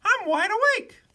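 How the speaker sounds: very high, loud little bird voice; high-pitched chirpy bird character. A narrator's reading. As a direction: squeak it out as a tiny bird. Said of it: "said the B B bird".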